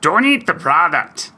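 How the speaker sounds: high monster puppet voice; Cookie Monster. Pretend. Host advises a Girl Scout seller, Cookie Monster impersonation. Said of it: "Cookie Monster".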